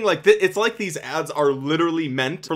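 ordinary talk

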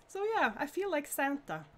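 ordinary talk

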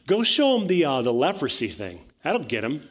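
hammed-up crowd cheering on their champion; eager Israelites. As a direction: egg Moses on, loud and high. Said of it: "they send Moses and Aaron".

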